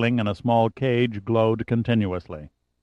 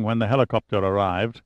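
ordinary delivery, nothing marked

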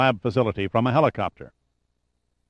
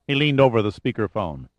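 ordinary delivery, nothing marked

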